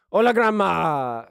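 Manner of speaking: lightly voiced grandson's call; Swancho. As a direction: call out hello as the dutiful grandson. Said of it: "and he goes".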